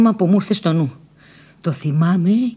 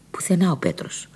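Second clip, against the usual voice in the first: whisper; 8 dB quieter than usual. A narrator's reading. Sudden dismay, Petros missing.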